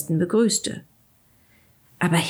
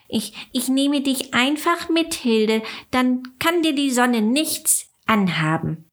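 eager ladybug voice; 6 semitones higher than usual. A narrator's reading. Willi pleads to save Hilde.

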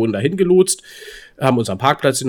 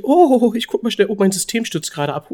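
much higher, flustered hostess; 7 semitones higher than usual. Retold or imitated. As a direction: squeak it like a rushed hostess.

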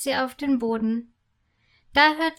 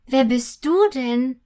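louder, inquisitive question; curious; 5 dB louder than usual. A narrator's reading. Sugar fairy to the newly arrived candy cane, curious. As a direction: ask with open curiosity. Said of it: "fragt sie neugierig".

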